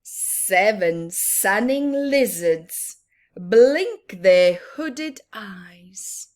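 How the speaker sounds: slow, lazy, drawn-out lines; slow lazy reading. Acted. Teacher reading the sleepy lizards page, slow and lazy. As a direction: let it laze like lizards in the sun.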